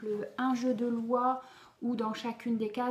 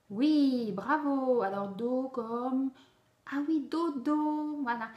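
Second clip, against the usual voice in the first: cheerful praise; 3 semitones higher, 4 dB louder, slower than usual. Acted. Teacher praises the child.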